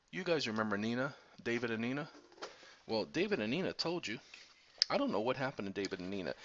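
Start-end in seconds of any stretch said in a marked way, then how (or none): none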